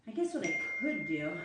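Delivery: ordinary delivery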